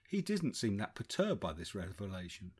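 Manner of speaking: plain reading voice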